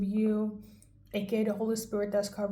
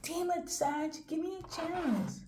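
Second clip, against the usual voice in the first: higher, softer, slow plea; a touch of a frustrated lover pleading, higher and softer; 6 semitones higher, 5 dB quieter than usual. Retold or imitated. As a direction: beg like a frustrated lover, lightly voiced. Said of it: "they're just like".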